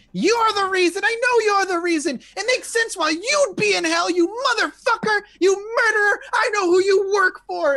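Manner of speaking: high, loud, tearful accusation; accusing. Acted. NPC jabs her finger at Moose, a hysterical accusing scream. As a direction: scream the blame at him. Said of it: "jams her finger into Moose's chest. She's like".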